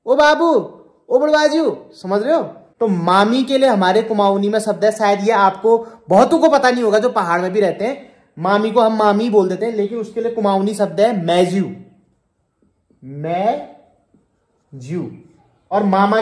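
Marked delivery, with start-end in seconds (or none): 0.1-1.8 higher-pitched demo calls; calling out. Shown as a demonstration. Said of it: "ओ मतलब बुलाने के लिए है". 13.0-15.1 "मैजू" very slow, split into मै and जू; syllable by syllable. Shown as a demonstration. Teacher sounds out new word slowly.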